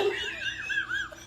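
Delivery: very high, soft squeal of laughter; helpless high giggle. Real, not acted. Laughing at onion slap.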